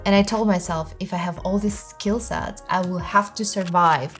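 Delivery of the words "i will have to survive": ordinary talk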